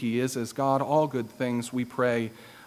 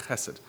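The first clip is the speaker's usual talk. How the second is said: "chesed" said softer, weight on "che"; stress on first syllable; 7 dB quieter than usual. Shown as a demonstration. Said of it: "Emphasis on that first syllable".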